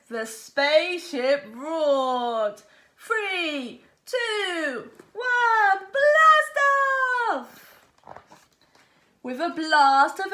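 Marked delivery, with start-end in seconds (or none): 3.0-7.6 countdown climbing higher and louder to a big shout; building countdown. Acted. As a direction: build it up to the launch yell. Rocket countdown.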